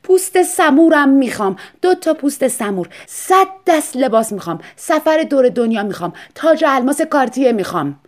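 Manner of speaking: high and loud, each item punched; demanding list. Acted. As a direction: reel off wants greedily. Wife lists her demands.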